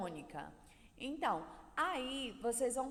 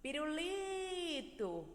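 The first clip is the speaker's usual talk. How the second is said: Pirulito with LI held long and high; calling it; 4 semitones higher, much slower than usual. Shown as a demonstration. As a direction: call Pirulito as if it were far away. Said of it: "você tivesse que chamar ele".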